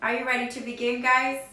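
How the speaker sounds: usual speaking voice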